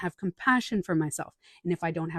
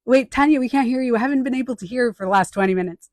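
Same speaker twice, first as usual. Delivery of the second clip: higher, louder mock audience calling out; imagined listener complaint; 5 semitones higher, 8 dB louder, faster than usual. To Tanya over a dead mic, mock call-out. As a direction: call out to her as a cut-off listener. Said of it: "I was like".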